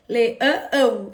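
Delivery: Leão stretched into le-ã-ão; imitating a child's chunked "Leão", le-ã-ão; child's syllable split. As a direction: break "Leão" up with a doubled nasal ending. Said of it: "Ele faz assim".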